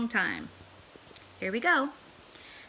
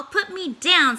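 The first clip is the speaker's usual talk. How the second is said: higher, louder, slow pleading fish; fish protesting; 8 semitones higher, 7 dB louder than usual. Acted. Fish held up by the cat, slow loud protest. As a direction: drag out the plea like a dangling fish. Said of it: "said the fish".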